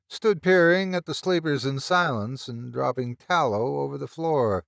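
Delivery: ordinary delivery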